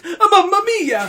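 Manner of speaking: high, loud hammed-up Italian exclamation; overplayed Italian cry; mock Italian accent. Joking friend calls it Chicago, loud mock Italian. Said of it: "here's my Chicago accent".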